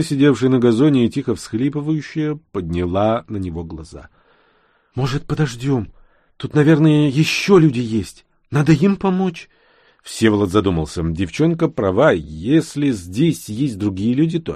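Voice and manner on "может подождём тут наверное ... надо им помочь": put-on high-pitched child's voice; little girl. A narrator's reading. Girl asks rescuer to help others, pleading.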